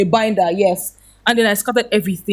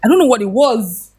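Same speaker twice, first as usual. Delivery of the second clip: ordinary talk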